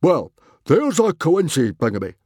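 high, loud bellow. A narrator's reading. Father fumbles a long word, bellowing. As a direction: bellow it like a blustering Viking chief. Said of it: "bellowed Stoic".

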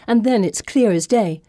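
plain reading voice

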